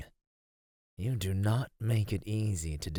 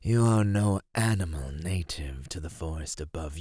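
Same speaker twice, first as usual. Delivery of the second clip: ordinary talk, nothing marked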